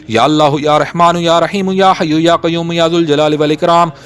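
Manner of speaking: usual speaking voice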